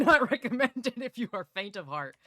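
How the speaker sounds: loud laugh breaking into the warning; giggling through a loud warning. Real, not acted. Laughs while warning about triggers.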